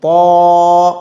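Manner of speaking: "पौ" as a heavy emphatic T with long rounded vowel; thick-sound demo. Shown as a demonstration. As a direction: hold "पौ" full and level. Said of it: "इस तरह से". ("पौ" को मोटा करके खींचें)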